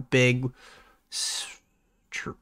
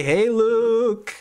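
chat shout-out; 8 semitones higher than usual. Shown as a demonstration. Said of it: "Everyone say".

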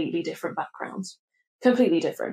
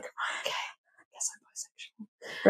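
unvoiced whisper, much softer; whispered to herself; 2 semitones higher, 9 dB quieter than usual. Arriving at college, whispering her realisation to herself. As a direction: breathe the realisation to yourself. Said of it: "I was like".

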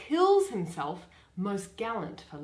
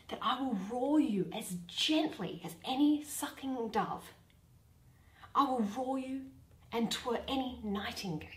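softer, cooing dove roar; gentle roar; 6 dB quieter than usual. Acted. Bottom promises the players a gentle, soft roar. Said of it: "I will aggravate my voice so".

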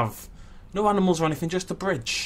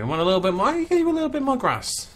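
cooing reassurance; 11 semitones higher, 4 dB louder than usual. Pretend. Streamer promising the zebra more grass, high cooing voice. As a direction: voice climbing very high.

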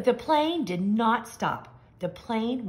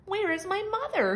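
put-on high, small baby bird voice; a lost baby bird calling for mom; baby bird asking; 9 semitones higher than usual. A narrator's reading. Baby bird asks where his mother is, high and small.

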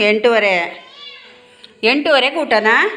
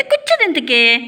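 usual speaking voice

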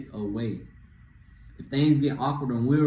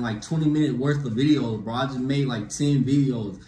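ordinary talk, nothing marked

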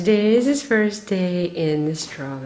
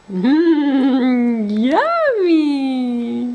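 sing-song yummy; 3 semitones higher, 5 dB louder, much slower than usual. Real, not acted. Encouraging baby's first bite.

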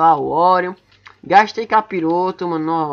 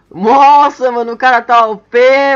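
amazed; 5 semitones higher, 8 dB louder, slower than usual. Real, not acted. Amazed at opponent.

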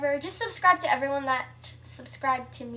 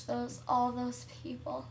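normal talking voice

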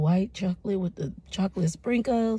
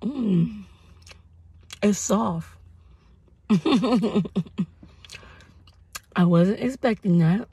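speaking between bites of donut; chewing while talking; slower than usual. Real, not acted. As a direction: mouth partly full of food. Seen 0: Bit donut at -5, chewing visibly throughout, takes more bites.

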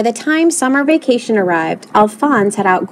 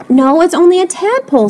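earnest protest; 8 semitones higher, 4 dB louder than usual. A narrator's reading. Lewis protests to panicking coach.

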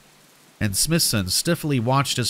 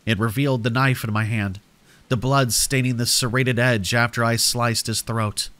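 plain reading voice

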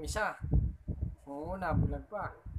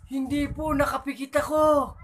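high coy granny; 10 semitones higher, 7 dB louder than usual. Acted. Granny telling the officer her eyes were shut. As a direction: admit it shyly like an old lady. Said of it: "Sabi naman ni Lola".

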